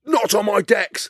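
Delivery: mock the old DJ protecting his decks; prissy DJ. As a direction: draw it out like a fussy DJ. DJ guarding his equipment.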